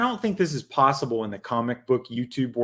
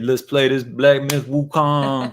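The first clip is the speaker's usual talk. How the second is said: slow, louder put-on old-gamer voice; mock gamer friend; 4 dB louder than usual. Host teases Jim about being old, slow put-on voice. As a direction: drag it out like a doddering gamer. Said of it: "He's like".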